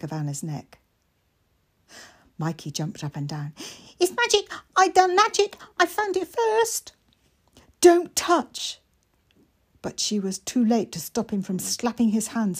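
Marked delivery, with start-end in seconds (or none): 4.0-6.9 high and louder, bubbling over; excited little boy. Acted. Mikey pointing out the marks to Anna, excited. 7.8-8.8 sharp, loud cry to stop him; urgent warning. Acted. Anna stopping Mikey from touching the marks, loud.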